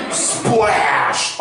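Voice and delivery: stretched-out splash sound; slow-motion splash. Retold or imitated. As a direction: draw out the word as if in slow motion. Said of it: "he's going in slow motion".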